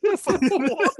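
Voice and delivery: high, loud laughing disbelief. Real, not acted. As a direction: crack up at the absurdity. Cracks up after own impression.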